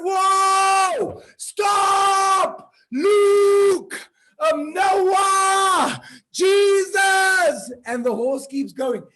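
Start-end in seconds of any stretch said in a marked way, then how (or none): 0.0-7.7 high, loud desperate yells; panicked shouting. Acted. Rider shouting in panic at the runaway horse. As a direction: scream every name trying to stop the horse.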